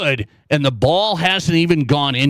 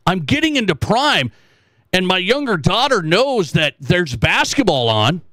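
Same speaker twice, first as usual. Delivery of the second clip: ordinary talk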